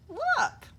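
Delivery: high sharp call of look; excited cry. A narrator's reading. Hugly alerting his friends, high excited cry. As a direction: point it out urgently.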